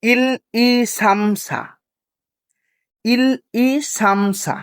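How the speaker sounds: very slow, each syllable drawn out; slow call-and-repeat drill for students; slow repeat-after-me. Shown as a demonstration. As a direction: recite so the class can follow along. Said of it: "আমার সাথে সাথে বলবেন।".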